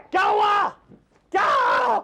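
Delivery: high, loud startled yell; alarmed shout. Acted. Son-in-law reacting to the old man's cry, alarmed shout.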